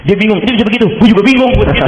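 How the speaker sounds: high, excited astonishment; amazed. Retold or imitated. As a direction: marvel loudly at what happened. Amazed retelling of the miracle. (takjub, suara tinggi)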